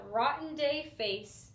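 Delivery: usual speaking voice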